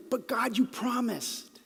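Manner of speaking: slow, questioning plea to God; doubting plea. Shown as a demonstration. Preacher voicing a doubter's plea to God. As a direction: say it as a doubting complaint. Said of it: "Or you can say it as an emphatic statement".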